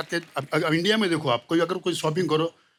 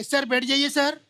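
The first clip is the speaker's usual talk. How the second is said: pleading air hostess; 8 semitones higher than usual. Retold or imitated. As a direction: beg passengers to sit down. Indian air hostess pleading passengers.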